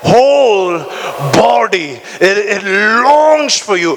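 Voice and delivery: word by word, slow and pressing; emphatic reading. Real, not acted. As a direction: space each word out. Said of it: "Read it with me".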